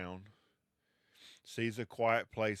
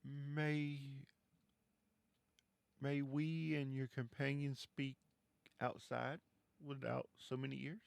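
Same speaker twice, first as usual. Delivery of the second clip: soft, higher, secretive voice asking to step outside; a quiet plea, keeping it from other ears; hushed request; 3 semitones higher, 7 dB quieter than usual. Acted. Dahlia asks the player to talk outside, hushed. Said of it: "she goes".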